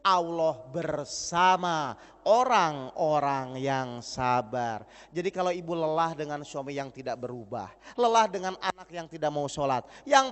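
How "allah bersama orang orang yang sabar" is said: very slow, low, drawn-out declaration; solemn verse. Real, not acted. Preacher stating the key verse to the mothers, slow and solemn. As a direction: intone it gravely like scripture.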